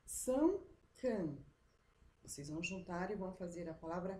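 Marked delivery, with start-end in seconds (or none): 0.0-1.4 "São Cã." loud and slow, two separate syllables; teacher reads the scrambled "São Cã."; syllables read apart. Shown as a demonstration. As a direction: sound out "São Cã." as scrambled pieces. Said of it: "tá escrito assim, ó".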